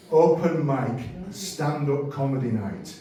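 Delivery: ordinary talk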